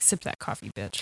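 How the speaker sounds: low, whispered mock jab; hushed sass. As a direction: mutter it under your breath. Acted freaked cop sipping coffee.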